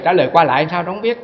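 ordinary talk, nothing marked

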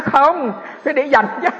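high, loud joking comeback; playful loud retort. Retold or imitated. Monk jokingly answers the neighbour, high and loud. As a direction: punch the punchline with mock indignation.

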